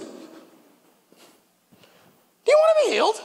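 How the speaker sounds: high, pointed question as Jesus; Jesus asking directly. Retold or imitated. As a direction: ask it straight at the man.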